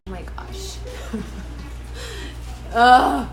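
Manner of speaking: whispered gasping laugh; breathless laugh. Real, not acted. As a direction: exhale it in a winded giggle. After being sprayed, breathless.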